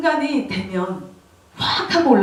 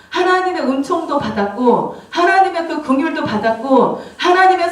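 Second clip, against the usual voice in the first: raised, insistent preaching voice, building through a list; impassioned preaching; 5 semitones higher than usual. Real, not acted. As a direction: preach it higher and with mounting force. Preaches with raised, mounting force. (점점 고조되는 열정적인 설교조)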